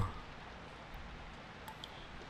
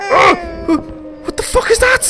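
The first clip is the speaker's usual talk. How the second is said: very high wailing cry of fright; a real shriek of disbelief; terrified cry; slower than usual. Real, not acted. Horror game jump scare.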